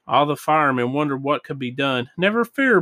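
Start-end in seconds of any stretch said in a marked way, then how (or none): 2.2-2.8 high-pitched, mildly raised call; confident shout. A narrator's reading. Bullwinkle reassures the worried firemen, mild shout. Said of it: "Bullwinkle shouted".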